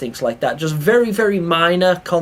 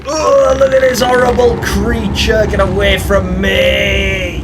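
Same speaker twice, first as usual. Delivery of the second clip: disgusted; 5 semitones higher, 5 dB louder, slower than usual. Real, not acted. Disgusted by horrible creature.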